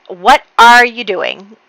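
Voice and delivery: loud, slow, incredulous question; lightly acted, stretched-out disbelief; startled demand. Woken woman confronts the man with a sword, startled demand. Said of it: "Serena wakes up and she's like".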